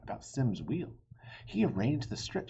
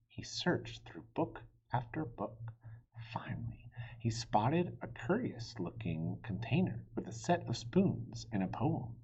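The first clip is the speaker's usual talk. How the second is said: usual speaking voice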